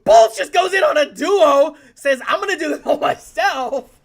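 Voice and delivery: loud high hype call; excited shouting. Real, not acted. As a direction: yell it as the duo falls. Caster shouts at a big play.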